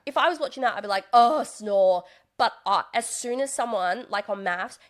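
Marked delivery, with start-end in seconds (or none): 1.1-2.0 mock bored groan. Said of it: "That's so boring".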